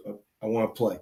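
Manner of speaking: low, soft, slurred plea; imitating a drunk roommate. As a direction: mumble it like a drunk begging to join. Drunk roommate begging again.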